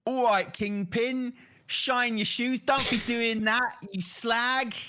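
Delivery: high, slow spoof superhero voice; put-on tough-guy hero, pitched high; Charlie Cox's Daredevil. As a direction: drag out a hammed-up threat in a high voice. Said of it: "do your best Charlie Cox doing Daredevil".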